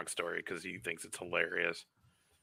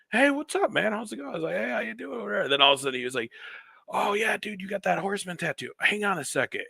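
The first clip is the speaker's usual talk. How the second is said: higher chipper mock greeting; imitating Justin Roberts; 10 semitones higher than usual. Fan retelling meeting the announcer, chipper higher voice. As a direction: voice Roberts as an excited fan friend.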